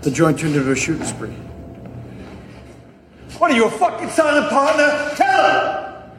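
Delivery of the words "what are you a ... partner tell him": high, yelling demand; shouting at a silent partner to tell the story; furious shout. Acted. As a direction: bark at him to speak up. Yelling at accomplice to speak up.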